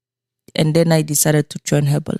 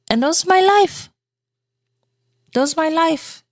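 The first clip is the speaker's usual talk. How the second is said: high wistful; 12 semitones higher than usual. Real, not acted. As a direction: say it high and rueful. High sad repetition of life.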